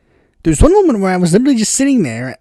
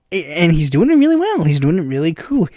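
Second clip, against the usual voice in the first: usual speaking voice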